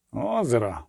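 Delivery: low, soft mutter. A narrator's reading. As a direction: murmur it low, half to yourself. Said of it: "пробурмотів Бонгард". (тихе низьке бурмотіння)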